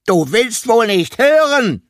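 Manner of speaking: angry scolding. A narrator's reading. Elephant scolding the climber who won't listen, angry. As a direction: let the anger boil over.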